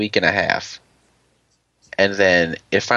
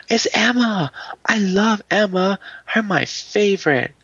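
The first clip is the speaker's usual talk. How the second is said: imitated excited screaming; 11 semitones higher, slower than usual. Imitating Kaylee thrilled to see her favorite, high mock squeal. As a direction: gush like an overexcited kid, mock-screamed. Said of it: "stop screaming their names".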